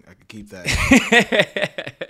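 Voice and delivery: laughing high voice. Retold or imitated. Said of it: "watch that back and be like".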